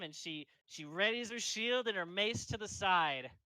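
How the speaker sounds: ordinary delivery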